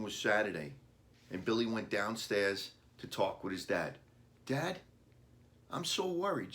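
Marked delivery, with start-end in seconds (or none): 4.4-6.5 put-on much higher, worried boy's sigh. A narrator's reading. Boy telling his dad he's worried, sighing high. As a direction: sigh it out like a fretting child. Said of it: "sighed Billy".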